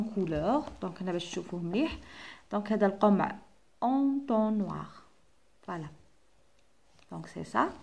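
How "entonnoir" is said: Entonnoir drawn out slowly; slow model word. Shown as a demonstration. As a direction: stretch Entonnoir syllable by syllable. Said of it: "j'entends wa". (Entonnoir ببطء)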